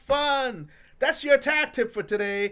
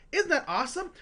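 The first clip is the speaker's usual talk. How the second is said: ordinary talk, nothing marked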